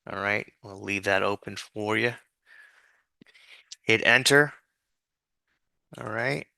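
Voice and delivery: ordinary delivery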